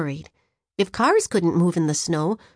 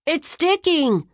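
excited call about the snow, higher and slow; holler; 6 semitones higher, slower than usual. Acted. Geraldo hollering to friends about the snow, excited holler. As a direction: shout the news to the other kids. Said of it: "Geraldo hollered".

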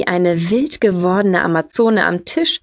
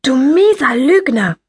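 high, heated accusation; outraged sibling cry; angry insult; 6 semitones higher, 4 dB louder than usual. A narrator's reading. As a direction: hurl the insult at your brother. Attacks brother, pulling his hair.

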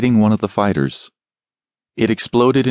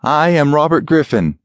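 higher, forced commanding tone; authoritative; 8 semitones higher, 3 dB louder than usual. Acted. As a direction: try hard to sound in charge. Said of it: "trying to sound authoritative".

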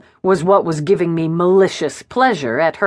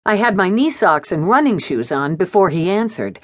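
plain reading voice

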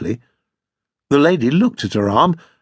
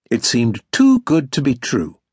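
ordinary delivery

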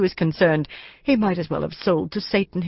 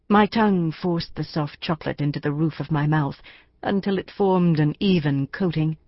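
ordinary delivery

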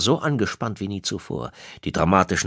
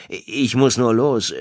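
slower, higher, insistent excuse; 5 semitones higher, 4 dB louder, slower than usual. Acted. Husband pulls free from his wife, insistent and higher. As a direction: press the point that you must leave.

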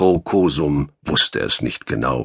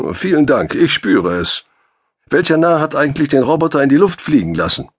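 higher, fast, ironic complaint; injured man needling his rescuer, faster and higher; sarcastic thanks; 9 semitones higher, 5 dB louder than usual. A narrator's reading. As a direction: bite back at the joke with dry sarcasm.

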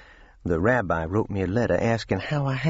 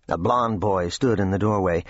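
ordinary delivery

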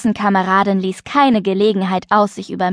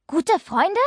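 high, quieter, sceptical echo question; restrained, annoyed doubt, pitched up; still cross; 9 semitones higher, 4 dB quieter than usual. A narrator's reading. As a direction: throw the words back as a sour question. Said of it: "Mia war noch immer sauer".

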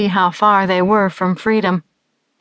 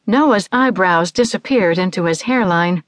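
plain reading voice

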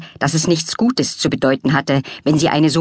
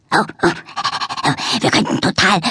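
panting like a dog; 3 semitones higher than usual. A narrator's reading. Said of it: "hechelte er begeistert".

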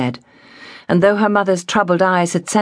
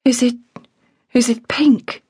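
higher, faltering dread; frightened girl; 8 semitones higher than usual. A narrator's reading. Girl asking about the rash, higher and afraid. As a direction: stammer out the fear.

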